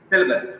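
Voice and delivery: "syllabus" with a short, squeezed middle vowel; correct syllabus pronunciation. Shown as a demonstration. Teacher states the correct pronunciation, "syllabus" as SIL-bus. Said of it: "The correct pronunciation is".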